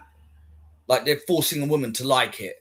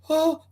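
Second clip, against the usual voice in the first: high mock gasp of "oh"; short, high-pitched acted gasp; shocked gasp; 13 semitones higher than usual. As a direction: imitate a startled discovery. Acting her shocked discovery.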